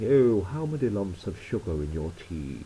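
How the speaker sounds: plummy upper-class English accent; a snooty posh Brit offering tea. Shown as a demonstration. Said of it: "we don't all talk like that".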